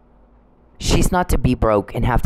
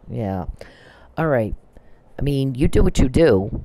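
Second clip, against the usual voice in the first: ordinary delivery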